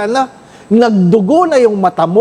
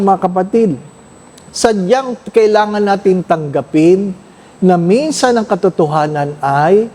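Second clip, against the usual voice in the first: normal talking voice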